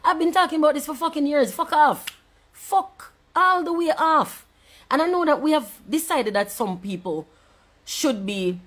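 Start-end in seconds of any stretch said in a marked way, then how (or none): 1.5-4.5 high, loud, dragged-out fuck off; furious dismissal. Real, not acted. Fed up with Jamaican media, a high slow fuck off. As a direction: stretch the last words out slowly, high and fed up.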